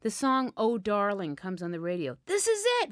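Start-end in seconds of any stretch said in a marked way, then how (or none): 2.2-2.9 excited friend. A narrator's reading. Friend excited over the song.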